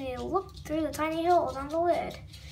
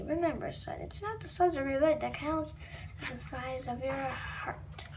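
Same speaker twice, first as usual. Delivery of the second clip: soft mom voice; 4 semitones lower, 7 dB quieter than usual. Acted. Mom's comforting words.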